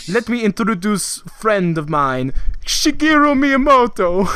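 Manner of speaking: put-on slow French-accented introduction building to a loud, high cry; hammed-up French accent, big finish; French-accented announcer. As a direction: grandly present a guest in a bad French accent. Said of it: "French guy who can't speak English very well".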